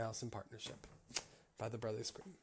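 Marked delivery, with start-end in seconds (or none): none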